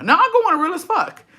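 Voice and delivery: high-pitched joking declaration; exaggerated, squeaky-high insistence; mock insistence. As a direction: swoop way up high on it. Jokes, then takes it back.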